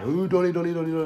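high-pitched mock doting coo; imitated high girlfriend. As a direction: imitate someone high fussing over a dog. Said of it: "This bitch is just high".

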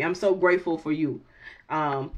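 usual speaking voice

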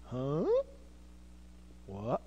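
soft, low, bewildered; hushed startled huh. Acted. Mary startled by hearing her name, soft and bewildered. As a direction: react stunned, barely aloud.

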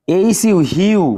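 "এইচ ইউ" বানান করে "হিউ" জোর দিয়ে বলা; "হিউ"-তে লম্বা ইউ ধ্বনি; ইউ উচ্চারণ দেখানো. Shown as a demonstration. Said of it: "ইউ উচ্চারণ করতে হচ্ছে". (শিক্ষকের মতো "এইচ ইউ, হিউ" ভেঙে বলা)

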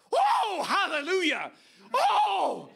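high, whooping hallelujah shouts; long, loud, high-pitched whoops of praise; imitated celebratory shout. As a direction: mimic an overjoyed groom hollering his praise. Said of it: "celebrate and shout".

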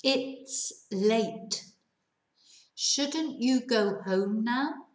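"Shouldn't you go home now" drawn out slowly, rising at the end; slow it down so learners catch every word; slow reading. Shown as a demonstration. Teacher reads the example slowly to students. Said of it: "voy a leerlo, lento".

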